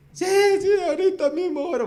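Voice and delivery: put-on high, loud excited child voice; eager little boy. Son eagerly agreeing to pray, high and loud. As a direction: jump in like a keen small kid.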